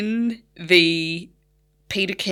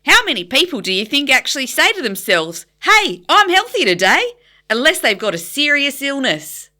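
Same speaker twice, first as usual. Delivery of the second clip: put-on high, loud, over-the-top Australian accent; exaggerated Australian accent; 8 semitones higher, 7 dB louder than usual. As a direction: ham up a broad Australian audiobook voice. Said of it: "That's what it sounded like".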